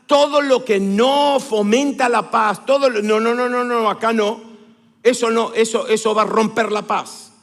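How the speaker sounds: loud, emphatic pulpit warning; forceful preaching. Real, not acted. Preacher warns church against breaking the peace, forceful. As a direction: drive each refusal home loudly.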